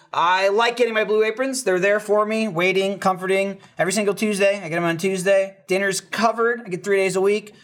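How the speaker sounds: ordinary talk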